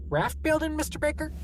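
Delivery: the clerk, confused by the question; puzzled clerk. A narrator's reading.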